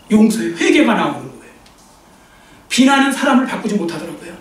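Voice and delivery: ordinary talk, nothing marked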